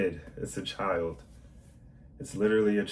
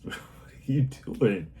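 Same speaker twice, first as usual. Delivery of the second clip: gentle slow scolding; 4 semitones higher, slower than usual. Retold or imitated. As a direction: tease a naughty child kindly, slowly. Adult talking to misbehaving child.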